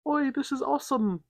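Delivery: high, hammed-up British dad, delighted; cheery Cockney "Oi" from the dad; British accent. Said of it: "he's like".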